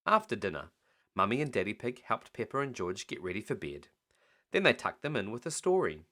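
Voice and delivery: ordinary delivery, nothing marked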